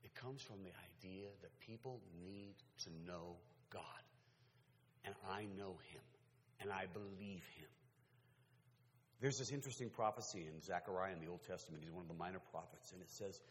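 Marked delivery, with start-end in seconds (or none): 0.0-7.7 low, soft testimony; hushed, earnest confession; sincere quiet conviction. Real, not acted. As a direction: confide your faith gently.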